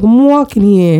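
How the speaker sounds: put-on a light New York street accent; mock New York accent, lightly done. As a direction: toss it off in a New York tough-guy accent. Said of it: "that New York, like, Boston kinda mashup".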